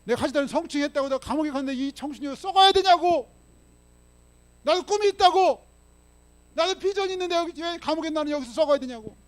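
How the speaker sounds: loud, high-pitched cry of injustice; indignant, full-voiced outburst; outraged protest. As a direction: shout it like a wrongly jailed young man protesting. Acting out wrongly jailed youth protesting.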